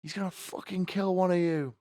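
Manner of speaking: high, frantic teacher yelling; a teacher herding kids inside in alarm; panicked teacher. Retold or imitated. Teacher at the bell, high frantic yelling. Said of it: "the teacher's, like, ringing the bell".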